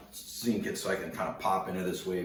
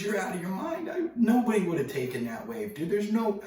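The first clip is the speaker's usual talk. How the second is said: high, loud disbelief; imitated surfer's scandalised yell; incredulous outburst; 6 dB louder than usual. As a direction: holler it like he's lost his mind.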